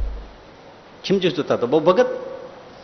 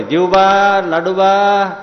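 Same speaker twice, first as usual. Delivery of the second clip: calling out; 4 semitones higher, much slower than usual. Acted. Maharaj calls the women.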